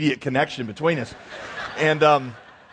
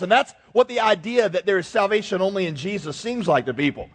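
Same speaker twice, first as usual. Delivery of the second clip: usual speaking voice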